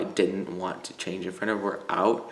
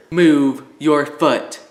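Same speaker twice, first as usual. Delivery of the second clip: a slow, loud yell, much louder; screamed; 4 semitones higher, 9 dB louder, much slower than usual. Retold or imitated. Gym teacher screaming at a student to move his foot. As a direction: bark it right into his ear, slowly. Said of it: "He screams in my ear".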